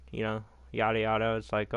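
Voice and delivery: usual speaking voice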